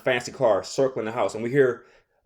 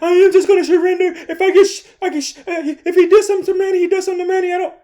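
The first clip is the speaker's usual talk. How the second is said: loud, very high mock whine; hammed-up panicky surrender, tripping over words; whiny Manny; 6 dB louder, much faster than usual. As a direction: babble frantically in a squealing put-on voice. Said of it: "So Manny's like".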